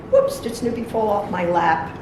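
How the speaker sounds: ordinary delivery, nothing marked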